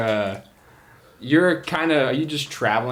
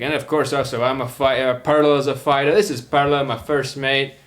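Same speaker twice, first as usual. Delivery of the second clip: put-on higher, louder accented captain; Armand, introducing crew; 4 semitones higher, 7 dB louder than usual. Captain introducing his first mate, hearty accented voice. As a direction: present the crew in a hearty skipper voice. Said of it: "he's like".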